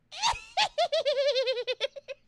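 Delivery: high shrill cackling witch laugh; witch cackle. Retold or imitated. Imitating the loud witch laugh of a comedy show audience member, high cackle. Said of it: "their laugh sounds like a witch laughing".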